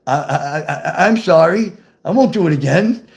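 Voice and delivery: retold stammered apology; stuttering. Nervous teen apologizing to his girlfriend, imitated stutter. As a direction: stumble on the first word, then apologize. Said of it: "I stuttered and said".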